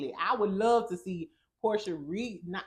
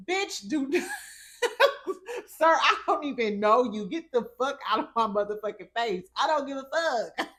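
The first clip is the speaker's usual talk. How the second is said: louder, higher laughing retort; laughing mock anger; 6 semitones higher, 5 dB louder than usual. Vlogger replaying how she snapped at the man. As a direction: fire it back while laughing. Retelling her angry reply.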